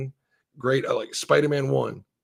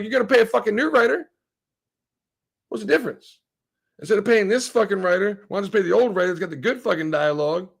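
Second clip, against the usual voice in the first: higher, fast indignant rant; mock rant at studios; 5 semitones higher, faster than usual. Streamer voicing his complaint to Hollywood, heated high rant.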